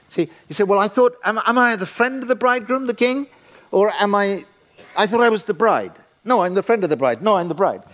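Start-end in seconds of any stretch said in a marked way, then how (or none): 0.6-5.9 lightly voiced puzzled churchgoer, higher; a bewildered listener asking aloud; mock confused believer. Congregation member voiced, confused.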